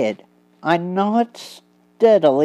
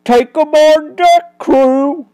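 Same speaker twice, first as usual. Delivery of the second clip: higher, louder crook's order; barked order; 8 semitones higher, 6 dB louder than usual. Acted. Barney ordering the crew to take prisoners, loud command. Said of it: "ordered Barney".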